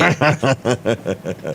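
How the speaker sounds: long run of chuckles, falling; amused laugh. Real, not acted. Host reacts to concrete-lifting joke, laughing. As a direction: laugh heartily, trailing downward.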